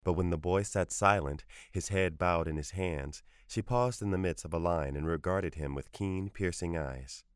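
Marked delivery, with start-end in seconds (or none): none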